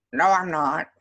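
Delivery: loud jokey protest; playful loud denial. Real, not acted. Teacher jokingly denies being the monster, loud and playful. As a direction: shout the denial at the class in fun.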